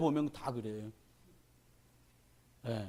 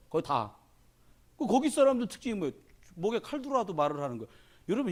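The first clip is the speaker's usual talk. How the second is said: ordinary talk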